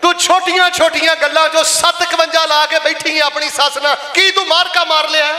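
high-pitched, scolding sermon delivery; impassioned preaching. Acted. Preacher admonishing a daughter-in-law about her mother-in-law, impassioned.

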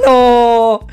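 high, loud cry ending in a long "no"; dismayed cry. Real, not acted. Gamer thwarted near the finish, loud high dismayed cry. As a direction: build to a drawn-out, high wail of protest.

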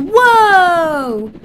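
long, high, loud whoop; excited whoa. Pretend. Player suddenly airborne on a helicopter, thrilled whoop. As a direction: cry out high and loud in amazement.

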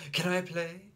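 put-on high eager child question; high pleading can I play; eager little boy. A narrator's reading. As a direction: beg to join in.

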